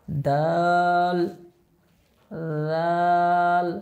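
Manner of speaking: দাল held very long, three alif lengths; drawn-out letter. Shown as a demonstration. Teacher drawing out দাল for the class. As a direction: stretch দাল out slowly. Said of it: "জাল উচ্চারণ করার সময়".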